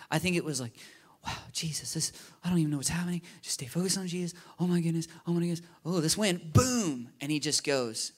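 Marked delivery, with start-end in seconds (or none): 1.2-6.5 rapid, higher-pitched flustered muttering to himself; hurried, acted spiral of alarm; very quick panic. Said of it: "I think it was very quick". 6.5-7.1 loud, higher exclamation; abrupt booming burst; sudden boom. Acted. Acting out the sudden sinking.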